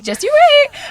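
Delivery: high, loud teasing line; mock-excited teaser; retold teasing promise. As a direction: say it high, like a playful warning. Retelling her gleeful reply.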